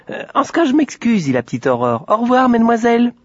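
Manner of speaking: put-on a sweet little girl asking politely. A narrator's reading. Aurore politely asks the shopgirls about the pig, high voiced.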